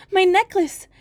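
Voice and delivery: put-on young girl's high wail; despairing maiden. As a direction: cry out your hopelessness. Maiden answers eagerly.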